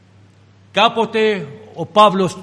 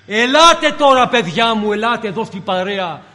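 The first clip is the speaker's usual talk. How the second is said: calling out; 3 semitones higher, 3 dB louder than usual. Retold or imitated. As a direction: call the children over, loud at first. Voices the Lord calling disciples over.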